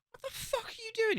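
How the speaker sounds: dad catching his son punching his sister, high shocked cry; shocked outburst. Retold or imitated.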